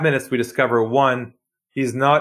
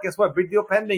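higher, eager boast; mock excited; 7 semitones higher, 4 dB quieter than usual. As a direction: brag to coworkers, hammed up a little. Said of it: "You go to work".

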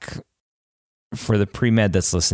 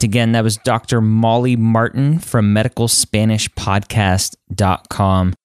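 louder upbeat outro read; podcast outro; 4 dB louder than usual. Acted. Host signing off the podcast, announcer read. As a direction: wrap up the show like an announcer.

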